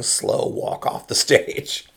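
usual speaking voice

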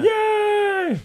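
very high squealing yay; Muppet yay. Retold or imitated. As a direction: squeal it like a puppet. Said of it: "the Muppet yay".

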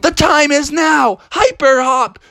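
high, emphatic battle cry; heroic rallying cry. Acted. Frogs launch their escape, high heroic cry. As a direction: announce the big move dramatically.

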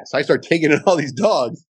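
usual speaking voice

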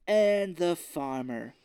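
slow, loud drawn-out address; mock theatrical villain. Villain turning to the farmer, slow mock pomp. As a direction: stretch it out with put-on pomp.

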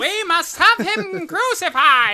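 high, loud, slow shouted cry; loud villain shout. Retold or imitated. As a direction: bellow it like a cartoon villain. Acting out the crowd's cry.

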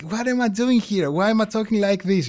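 high-pitched, incredulous questioning of oneself; mock bafflement, voice pitched well up; retold self-mockery. YouTuber reacting to their old videos, high mock disbelief. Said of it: "this is very funny because I'm like".

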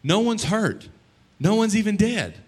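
exasperated. Real, not acted. Preacher exasperated at complainers.

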